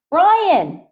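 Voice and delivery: loud call across the room; shout. Acted. Friend calling Brian across the lunchroom, loud shout. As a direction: call his name out loud.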